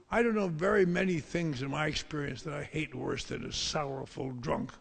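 usual speaking voice

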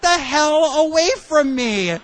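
high, sharp retort; mock snap back; angry brush-off. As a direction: push him away with your voice. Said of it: "And I was like".

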